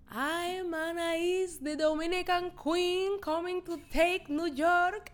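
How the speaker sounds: high, drawn-out mock self-introduction; Anais impression. Co-host imitating a reality star's grand introduction, high and slow. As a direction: stretch it out high, like a reality star announcing herself.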